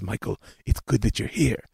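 put-on soft, heavy Eastern European accent; quiet old aunt, Eastern European accent. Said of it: "in her thick Eastern European accent".

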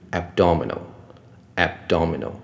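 慢慢念“abdominal”，ab直接接do; “abdominal”的b后不插元音; b不加母音. Shown as a demonstration. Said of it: "B后面它并没有母音". (放慢示范“abdominal”：ab-dominal)

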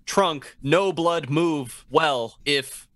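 slow, laughing caveman talk; playful caveman, drawn out slow. Acted. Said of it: "You can only talk like cavemen".

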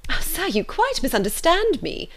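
high, flustered protest; alarmed mother, pitched well up. A narrator's reading. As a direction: jump in alarmed to correct him.

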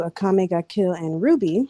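usual speaking voice

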